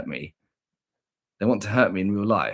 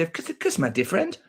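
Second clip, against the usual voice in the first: silly catchphrase voice; 6 semitones higher than usual. Acted. Streamer asking if babies say this, high silly voice. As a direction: squeak the first words, then drop. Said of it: "Have you ever heard a baby say".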